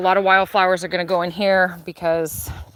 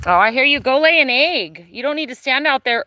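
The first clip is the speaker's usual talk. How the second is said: talking to a hen; 7 semitones higher, 3 dB louder than usual. Real, not acted.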